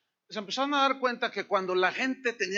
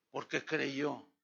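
ordinary talk

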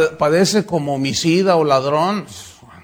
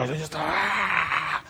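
dog-like snarl; 7 dB quieter, slower than usual. Retold or imitated. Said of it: "se les paran los pelos".

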